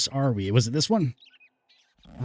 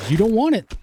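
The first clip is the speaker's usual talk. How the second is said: mocking taunt; 9 semitones higher than usual. Taunt repeated, higher and louder.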